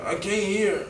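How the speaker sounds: high, weak, strained voice; imitating his wounded self. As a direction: say it high and faint, struggling. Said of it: "this is exactly how I was talking".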